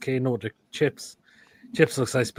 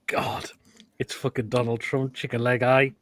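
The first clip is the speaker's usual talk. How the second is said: usual speaking voice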